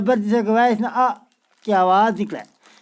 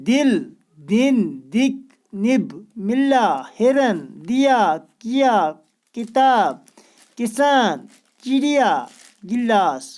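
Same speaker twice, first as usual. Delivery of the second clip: slow drill reading; slower than usual. Shown as a demonstration. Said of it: "teacher reading word list to children".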